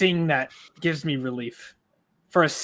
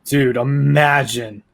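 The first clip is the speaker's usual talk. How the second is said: loud, excited, building higher; getting louder and higher as it builds; hyped shout; 5 semitones higher, 10 dB louder than usual. Real, not acted. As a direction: fan shouting at a dragon battle. Hyped by dragon fight.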